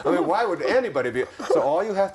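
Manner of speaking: laughing along. Real, not acted. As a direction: chuckle through the question. Laughing with the listener.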